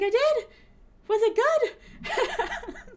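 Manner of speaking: high, loud, slow giggly question; dazed surprise. Retold or imitated. Retelling asking friends about the sake, tipsy giggle. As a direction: ask it tipsy and delighted. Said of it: "I'm like".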